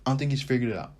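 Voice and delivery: usual speaking voice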